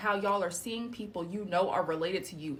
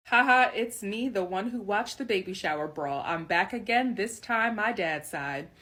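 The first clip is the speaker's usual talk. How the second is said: usual speaking voice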